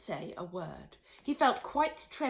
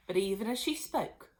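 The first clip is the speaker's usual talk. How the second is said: plain reading voice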